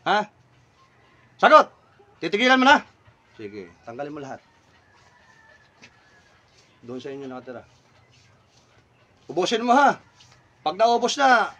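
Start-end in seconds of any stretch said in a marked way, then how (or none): 0.1-2.8 sharp, loud, high shout; barked demand. Real, not acted. Demands spirit agree to stop. 3.4-7.6 much lower, softer voice; quiet concession. Real, not acted. Eases off after the answer.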